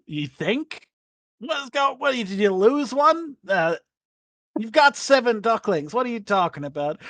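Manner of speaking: bewildered at her count; exasperated landlord. Acted. Landlord questioning Mildred about her ducklings, incredulous.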